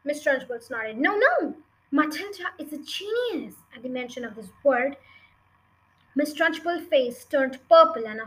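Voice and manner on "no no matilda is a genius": high-pitched, swooping protest; higher, emphatic teacher's voice; earnest insistence. A narrator's reading. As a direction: insist earnestly on the child's talent. Teacher insists against headmistress.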